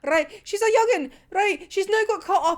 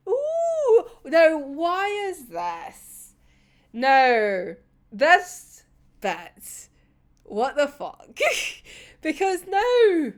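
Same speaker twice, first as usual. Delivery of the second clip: normal talking voice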